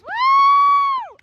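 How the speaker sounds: loud high-pitched woo shout; high cheering whoop. Real, not acted. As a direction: whoop for the big run. Parent cheers after run.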